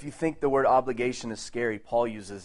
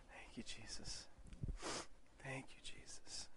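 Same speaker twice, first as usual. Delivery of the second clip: barely audible, breath-only thanks; whispered prayer; 21 dB quieter than usual. Real, not acted. Preacher thanking Jesus while the church prays, whispered. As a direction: whisper it privately to God. Private prayer during silent praying.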